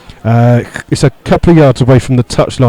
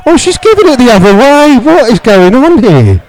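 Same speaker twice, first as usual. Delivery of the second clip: high, louder cry of real disbelief; incredulous outrage; 11 semitones higher, 4 dB louder than usual. Real, not acted. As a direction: protest the referee's call, pitch shooting up. Disbelief at referee's decision.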